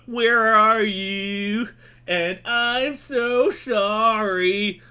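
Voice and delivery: high, slow mock-sung American pop punk accent. Reviewer mocking Aussie pop punk singers, slow high American-accent parody. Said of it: "Stop that accent immediately".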